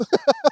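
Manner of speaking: sharp high burst of laughter. Real, not acted. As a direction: let a squeal of a laugh out.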